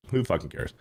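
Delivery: ordinary talk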